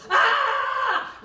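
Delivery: short, high, loud mock shriek; imitated fan scream. Stranger running into the actress, high mock shriek. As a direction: let out a fan's sudden hammed-up shriek.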